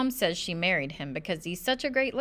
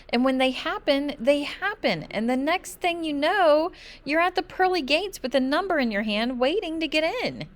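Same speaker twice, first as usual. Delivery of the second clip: high, loud, worried rush; anxious insistence; 9 semitones higher, 3 dB louder than usual. A narrator's reading. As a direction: convince Dad of the danger, high and urgent. Child insists on doom.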